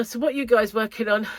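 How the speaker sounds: usual speaking voice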